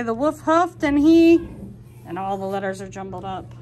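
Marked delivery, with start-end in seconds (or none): 0.0-1.4 much louder, building storybook reading; dramatic read-aloud. Acted. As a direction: read it big for the kids. Reading jumbled story text.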